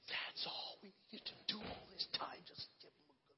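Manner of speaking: breathless schoolkid whisper; soft unvoiced whisper; awed whisper. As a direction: hush it like stunned kids. Kids whisper after the fight.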